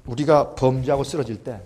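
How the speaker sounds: ordinary delivery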